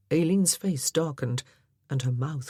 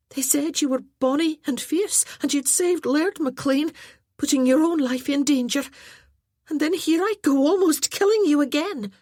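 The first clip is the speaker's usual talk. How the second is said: put-on much higher, louder, rushed girlish gushing; a starstruck girl tumbling over her words; flustered young lass; 11 semitones higher, 6 dB louder than usual. A narrator's reading. Aileen voiced in story.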